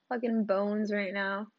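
usual speaking voice

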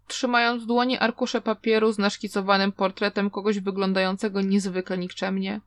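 usual speaking voice

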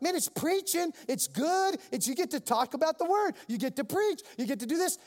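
imitating eager persuaders, high voice; high-pitched, coaxing enthusiasm; eager persuading. Acting out people urging him.

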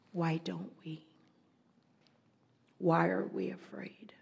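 hushed questioning whisper. Acted. Preacher whispering a question to the congregation. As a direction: ask it in a whisper.

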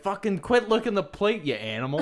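angry parent. Retold or imitated. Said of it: "my fucking parents were like".

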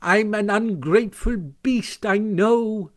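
high, tearful self-reproach; sobbing. A narrator's reading. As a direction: wail it as if in tears. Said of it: "sobbed Toad, shedding bitter tears".

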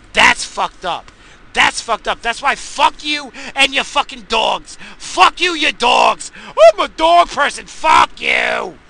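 loud, high angry shouting; real fury at the neighbors; furious rant. Real, not acted. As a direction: yell it at the dog owners. Ranting rage at dog owners.

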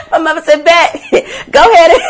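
words pushed out high and loud through laughter; can't get the line out for laughing; laughing while talking. Real, not acted. Retelling mom's answer to viewers, laughing hard and high.

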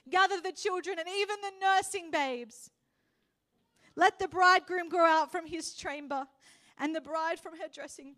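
ringing scripture reading at preaching force. Acted. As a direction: proclaim it to the congregation. Reads scripture to church.

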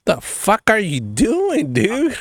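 shocked and half laughing at him; startled outburst. Real, not acted. Friend startled by flashing light.

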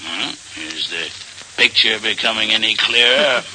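ordinary talk

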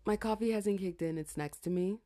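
ordinary talk, nothing marked

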